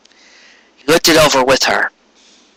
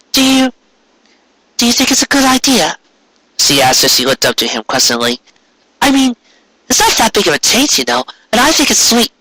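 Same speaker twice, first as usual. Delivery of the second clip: higher mare voice, hopeful and unsure; higher voiced wife asking husband's approval; questioning wife voice; 10 semitones higher than usual. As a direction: ask him earnestly.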